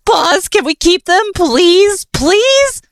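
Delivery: high, loud begging, rising please; pleading. Acted. Killer begs Nightmare to keep the kittens, high and loud. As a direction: beg like an eager kid.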